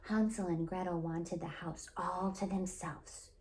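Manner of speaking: ordinary delivery, nothing marked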